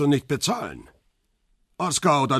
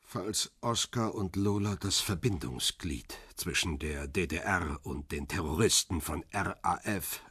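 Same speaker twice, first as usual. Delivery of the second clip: low menace; 6 semitones lower, 8 dB quieter than usual. Acted. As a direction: spell out the accusation like a threat. Interrogator lays out the accusation.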